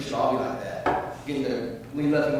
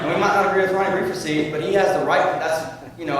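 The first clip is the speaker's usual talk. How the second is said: ordinary talk, nothing marked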